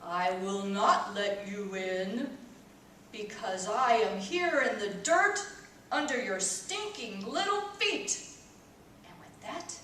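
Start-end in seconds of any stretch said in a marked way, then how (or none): 0.0-8.2 low, slow, gravelly voice from the dirt. A narrator's reading. Feet water answering from the dirt, low and gravelly. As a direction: growl it up from under the ground. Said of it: "a very low, gravelly voice".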